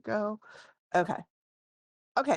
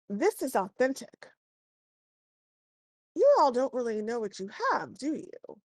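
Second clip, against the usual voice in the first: higher, knowing appraiser; shopkeeper voice; 6 semitones higher than usual. Acted. Appraiser telling the party their necklace is authentic, higher and knowing. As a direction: let them know they've missed something.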